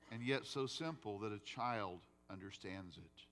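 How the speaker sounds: ordinary talk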